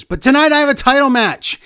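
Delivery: high, loud retold proclamation; mock promo hype. Retelling Big E's backstage interview, high and loud hype. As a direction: punch it up like a hyped wrestler.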